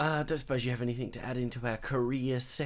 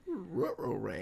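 slow, soft cartoon dog growl; Scooby-Doo voice; 8 dB quieter, slower than usual. Co-host jokes with a slow Scooby-Doo dog voice. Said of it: "like, bloody Scooby-Doo".

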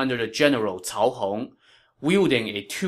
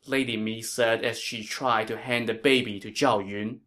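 ordinary delivery, nothing marked

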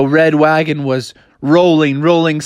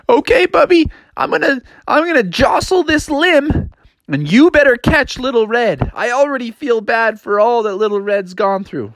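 high sister cub voice; 8 semitones higher than usual. Acted. Sister on the limb.

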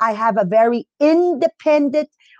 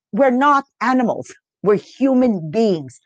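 ordinary talk, nothing marked

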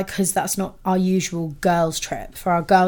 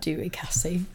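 whispered, much softer; whispered aside; 2 semitones lower, 10 dB quieter than usual. Real, not acted. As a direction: lean in and whisper to Cassie.

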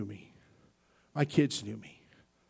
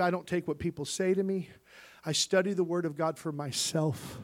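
normal talking voice

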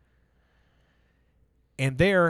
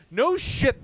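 mock surprise; 10 semitones higher than usual. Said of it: "I'm like".

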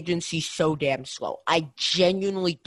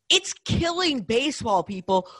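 emphatic outcry; 6 semitones higher than usual. Real, not acted. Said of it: "that's a really good point".

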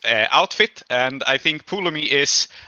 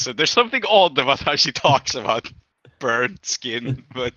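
usual speaking voice

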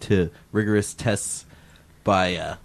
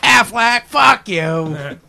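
loud mocking impression; 12 semitones higher, 8 dB louder, much slower than usual. Impression round.

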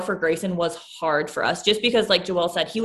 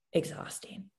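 breathy whisper, voice gone; whispered exhausting; 3 semitones lower, 13 dB quieter than usual. Real, not acted. Mom recalling early newborn days, whispering exhausting. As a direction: let the word exhausting drain out as a whisper. Tired mom sighing the word.

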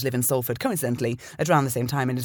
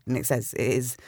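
normal talking voice

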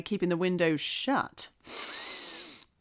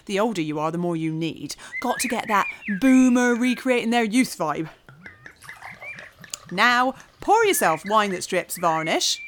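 normal talking voice

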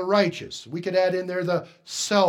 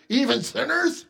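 higher, rising, offended repeat of "Even sinners?"; indignant echo; 5 semitones higher, 4 dB louder than usual. Retold or imitated. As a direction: toss "Even sinners?" back in disbelief. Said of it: "Can't you just hear it?".

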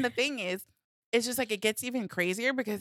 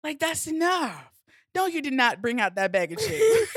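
higher-pitched outside observer telling you off; scolding inner voice; 7 semitones higher, 4 dB louder than usual. Retold or imitated. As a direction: scold yourself in a raised, mock-outraged voice. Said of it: "this outside third person watching yourself".

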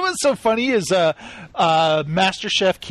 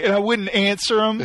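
ordinary talk

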